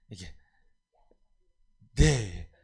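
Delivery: normal talking voice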